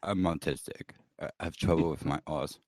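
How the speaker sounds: low, halting character voice with weak R's; put-on impaired-speech voice. Comedian explains himself to a co-host, put-on speech-impediment voice. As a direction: keep it deep and deadpan, R's softened.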